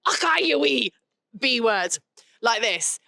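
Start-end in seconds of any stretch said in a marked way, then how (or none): 0.1-0.9 higher-pitched mock Scottish outburst; broad Scottish accent. Mate pokes his head round to swear at him, broad Scottish. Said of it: "he's got a very broad Scottish accent".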